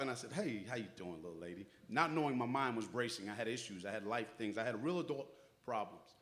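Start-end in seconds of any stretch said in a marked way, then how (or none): 0.3-1.7 tired reply. Retold or imitated. Grandfather answers granddaughter, tired.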